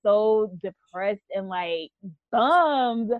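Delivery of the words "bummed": usual speaking voice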